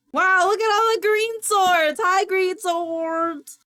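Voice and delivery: high, loud gushing wonder; excited greeting. Real, not acted. Streamer hailing the green swords in chat, excited.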